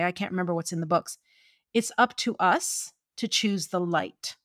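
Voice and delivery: ordinary talk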